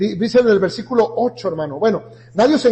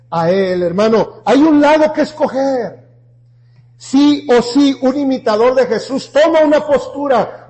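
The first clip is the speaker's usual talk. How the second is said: fiery preaching; 4 semitones higher, 6 dB louder than usual. Acted. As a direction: preach it hard to the congregation. Keeps preaching at full force.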